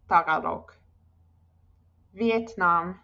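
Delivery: normal talking voice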